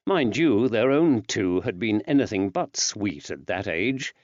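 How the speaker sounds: ordinary delivery, nothing marked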